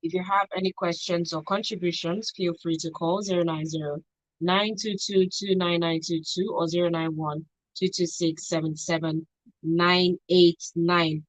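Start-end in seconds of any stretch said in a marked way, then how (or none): none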